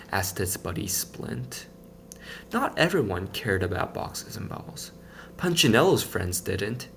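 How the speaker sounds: ordinary delivery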